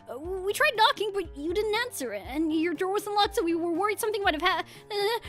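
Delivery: lightly voiced anxious agent. Agent explaining the break-in to Dot, lightly anxious.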